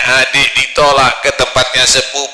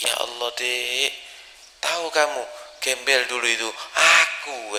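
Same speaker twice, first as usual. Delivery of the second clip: tearful confession; 7 dB quieter than usual. Retold or imitated. Husband reveals to wife he was the beggar, soft. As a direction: confess quietly with emotion. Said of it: "kata dia".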